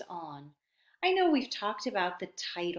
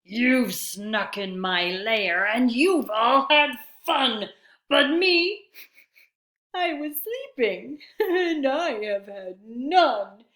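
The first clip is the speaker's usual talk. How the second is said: grumpy bear; 3 semitones higher, 6 dB louder than usual. Acted. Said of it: "Bear roars and he rumbles".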